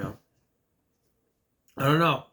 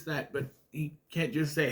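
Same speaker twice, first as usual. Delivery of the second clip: ordinary delivery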